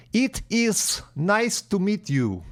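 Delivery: slow Russian accent, "It is" as "eet ees". Shown as a demonstration. Teacher showing how Russians say it, slow Russian accent. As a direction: imitate a Russian speaker's English. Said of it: "Russians will say".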